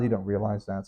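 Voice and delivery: ordinary talk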